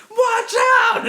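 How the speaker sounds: piercingly high shouted warning; mock scream. Girl warning her friend, very high mock scream. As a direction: scream it in hammed-up panic. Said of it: "Like, she just screamed".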